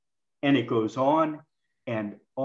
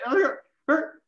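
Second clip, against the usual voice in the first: pained groans; 12 semitones higher than usual. Shown as a demonstration. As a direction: groan it again like someone hurting. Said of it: "I, I heard, hear it".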